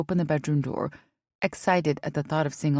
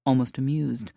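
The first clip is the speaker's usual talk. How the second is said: plain reading voice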